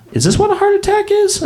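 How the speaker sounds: very high, strained; panicked squeal. Pretend. His imagined last words in a Pizza Hut, a high panicked squeal.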